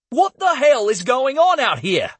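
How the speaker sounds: high, demanding outburst. Acted. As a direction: demand an answer sharply. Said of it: "he demanded".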